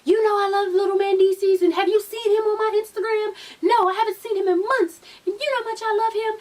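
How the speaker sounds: high-pitched, hammed-up crying voice; mock tearful pleading. Yandy crying to Kimbella about Mandesis, mock tearful. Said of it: "they start crying".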